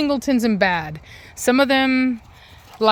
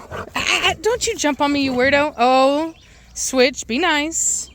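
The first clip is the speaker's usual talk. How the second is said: playful scolding; 6 semitones higher than usual. Real, not acted. Scolding dogs jumping on her.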